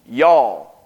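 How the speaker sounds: "Y'all" drawn out as one syllable, yawl; a North Carolina "Y'all"; Southern "y'all". Shown as a demonstration. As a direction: say "Y'all" as a Carolina native would. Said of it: "It stands for you all".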